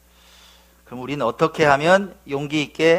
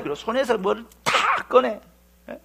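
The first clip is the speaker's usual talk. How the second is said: usual speaking voice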